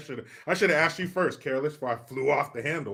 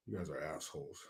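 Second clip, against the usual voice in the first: muttered aside; 11 semitones lower, 20 dB quieter than usual. Real, not acted.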